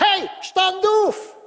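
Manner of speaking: very high, loud, slow command; commanding shout. Acted. God ordering the self-pitying believer up, loud slow shout. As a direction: roar it like a wake-up order.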